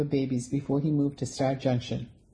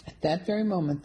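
ordinary delivery, nothing marked

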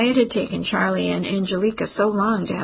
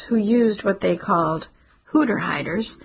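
ordinary delivery

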